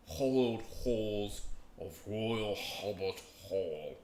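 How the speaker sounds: slow, drawn-out mock Manchester accent; Davey Boy Smith. Streamer mimicking a wrestler's interview, mock Manchester accent. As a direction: stretch each word in an imitated Manchester accent. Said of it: "he has a very thick accent".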